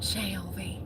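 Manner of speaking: a soft, lingering name; romantic. Pretend. Saying a viewer's name softly like a romance novel hero. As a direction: breathe the name out dreamily. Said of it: "I make it so romantic".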